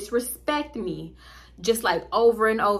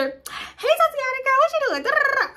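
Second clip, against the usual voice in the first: put-on much higher sing-song girl voice; mock little sister; 3 dB louder than usual. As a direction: play the chirpy little sister, pitched way up.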